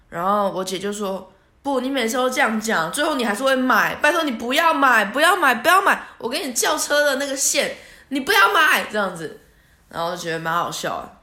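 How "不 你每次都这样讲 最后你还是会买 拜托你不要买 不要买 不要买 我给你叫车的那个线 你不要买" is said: higher and louder, begging harder and harder; pleading sister. Retold or imitated. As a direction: beg your sister not to buy the car. Sister begging her not to buy.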